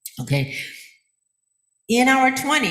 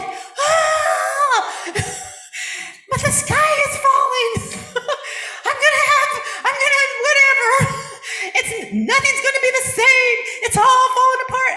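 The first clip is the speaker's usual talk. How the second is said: high, loud, laughing parody of a crying granddaughter; mock panicked wail; 5 dB louder than usual. Grandmother mimicking her crying granddaughter, laughing mock panic. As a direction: wail that the sky is falling, hammed up through laughter. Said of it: "she's like".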